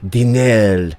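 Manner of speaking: clumsy French with theatrical relish; bad French accent. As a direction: ham it up as a French gourmand. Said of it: "I cannot do a good French accent".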